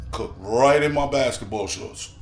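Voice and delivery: ordinary talk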